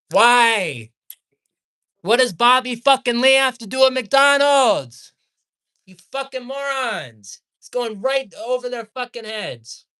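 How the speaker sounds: high, outraged yelling; heated. Real, not acted. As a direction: burst out loud, then keep railing. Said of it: "I'm getting heated".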